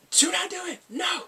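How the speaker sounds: high-pitched mock alarm; urgent warning. Viewer voices the doctor warning the team, urgent. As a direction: stop them with a high cry.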